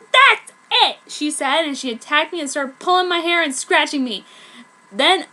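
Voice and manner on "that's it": loud, high snap; that's it, she's had enough; enraged. Acted. Prep about to attack.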